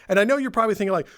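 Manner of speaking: ordinary talk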